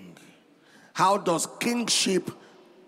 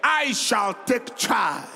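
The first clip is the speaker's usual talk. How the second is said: preaching at full force; 6 semitones higher than usual. Real, not acted. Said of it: "Say it louder".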